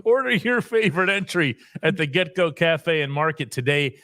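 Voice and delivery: ad read breaking into laughter; can't keep it together, laughing through the line; giggling through the ad. Real, not acted. Host reading the sponsor ad to listeners, cracking up. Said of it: "I can't do this with".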